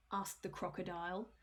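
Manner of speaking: plain reading voice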